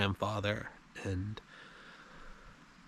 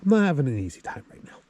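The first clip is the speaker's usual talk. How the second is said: emotional; 3 semitones higher, 5 dB louder, faster than usual. Real, not acted. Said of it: "I'm a little emotional".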